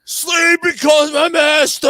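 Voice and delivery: loud, high, drawn-out scream of a lyric; imitated screaming. Friend up on the concrete barrier at the show, screaming the lyric. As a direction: belt it like a crazed metal fan. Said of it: "he's fucking screaming".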